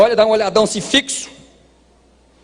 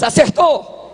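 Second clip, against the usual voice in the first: call and response shout; 7 semitones higher, much slower than usual. Real, not acted. Preacher calls sisters to shout acertou. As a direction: shout it out high. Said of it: "Diga assim, irmãs".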